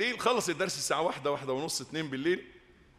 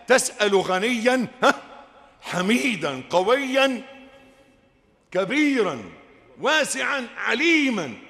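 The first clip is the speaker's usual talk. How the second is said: louder and higher, each word landing harder; building proclamation; 4 semitones higher, 6 dB louder, much slower than usual. Acted. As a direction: build the list to a shout. Listing God's attributes, building.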